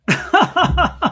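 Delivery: high, loud burst of laughter; hearty laugh. Real, not acted. Author cracking up over a line in the book, hearty laugh.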